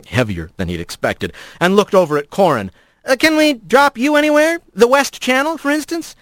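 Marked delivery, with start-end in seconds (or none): 3.1-6.2 high, sugary fake helpfulness; mock-polite jab. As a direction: offer it sweetly, meaning the opposite. Rat needles Corin with mock offer.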